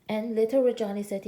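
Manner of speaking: ordinary delivery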